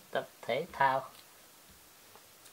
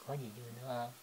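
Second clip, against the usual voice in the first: much softer, hushed repeat of the question; gently repeating the question much more quietly; 5 semitones lower, 17 dB quieter, faster than usual. Real, not acted. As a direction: ask again, barely above a breath.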